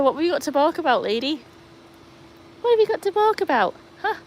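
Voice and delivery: high, playful questions to the dog; sing-song scolding of the dog; teasing pet talk. Real, not acted. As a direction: tease the dog for barking.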